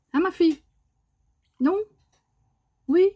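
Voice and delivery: high, bright coo to the bird; high doting pet talk. Real, not acted. Talking to the bird.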